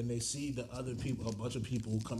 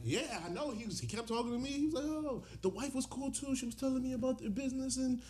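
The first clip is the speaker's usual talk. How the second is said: high mock spouse voices; 12 semitones higher, faster than usual. Wife and husband quotes.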